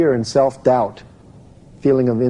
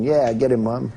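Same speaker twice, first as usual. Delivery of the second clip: ordinary talk, nothing marked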